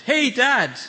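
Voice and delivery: put-on high, loud yell of a boy mid-jump; boy's yell. Son yelling to his dad while jumping. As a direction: call out as you leap. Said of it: "He jumped and then yelled".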